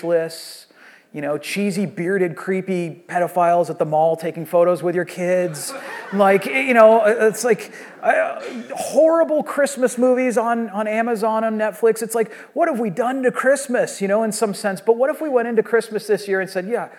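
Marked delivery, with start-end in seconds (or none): 6.1-16.3 higher and louder, building exasperation; preacher getting heated over Christmas; worked-up rant. Real, not acted. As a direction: let it spill out, fired up. Preacher rants about Christmas.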